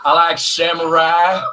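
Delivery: put-on slow, botched American accent with a Southern slant; drawn-out fake Southern-sounding American; Mary impression. Asked to mimic a co-host, badly faked Southern accent. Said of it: "Do your best Mary impression".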